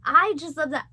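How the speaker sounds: ordinary delivery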